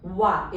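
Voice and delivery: "Wa" as a clear wa; wa sound. Shown as a demonstration. Teacher demonstrating French oi to students, clear wa. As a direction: model the sound "Wa" for oi. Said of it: "It is pronounced as wa".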